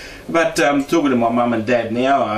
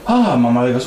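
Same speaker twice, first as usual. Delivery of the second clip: normal talking voice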